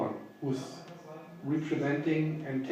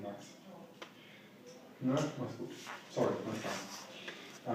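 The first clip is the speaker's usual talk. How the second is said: hushed, hurried words into the phone; quiet phone aside; 2 semitones lower, 9 dB quieter than usual. Real, not acted. Takes a phone call mid-interview.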